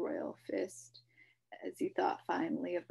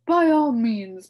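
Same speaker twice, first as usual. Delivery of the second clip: high, loud, grand humbug voice; pompous announcement; 4 semitones higher, 16 dB louder, slower than usual. A narrator's reading. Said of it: "he announced to no one".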